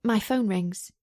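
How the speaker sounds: ordinary delivery, nothing marked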